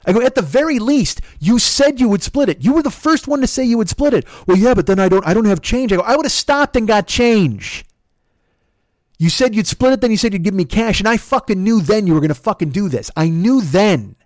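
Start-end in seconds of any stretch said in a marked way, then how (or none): 0.2-4.3 high, insistent arguing; angry accusation. Retold or imitated. Driver reminding passenger of the promised split, angry accusation. 4.5-5.9 loud, high mimicked excuse; agitated excuse. Passenger excusing themselves to driver, agitated excuse. 6.0-14.2 high, heated, accusing voice; angry tirade. Retold or imitated. Driver accusing passenger of breaking a promise, angry tirade.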